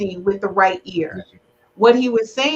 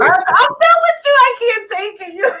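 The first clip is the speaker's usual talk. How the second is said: mock exasperation; 11 semitones higher, 4 dB louder than usual. Mock outrage at guest's answer.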